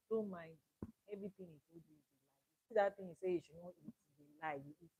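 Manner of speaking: quick, hushed coaxing of a tempter; sly tempting murmur. Retold or imitated. Lucifer tempting Adam and Eve.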